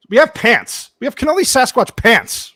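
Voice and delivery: loud, high announcement of new merch; excited merch hype. Real, not acted. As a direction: hype it up loudly, pitch jumping high. Hyping the new store's merch.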